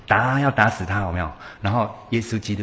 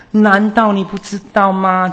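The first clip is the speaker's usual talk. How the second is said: challenging question; 11 semitones higher, 6 dB louder than usual. Retold or imitated.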